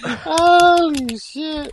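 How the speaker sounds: laughing. Real, not acted. Laughing at the intro mix-up.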